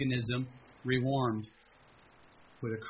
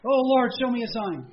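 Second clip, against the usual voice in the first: higher, louder begging; plead, missing the obvious; desperate plea; 8 semitones higher, 6 dB louder than usual. Retold or imitated. Man ignoring the weeping stranger, begging for a sign.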